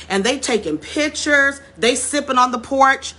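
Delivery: ordinary talk, nothing marked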